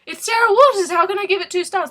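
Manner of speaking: high, loud indignant disbelief; acting scandalised at her own rating; mock outrage. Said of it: "because I'm like".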